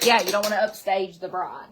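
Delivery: usual speaking voice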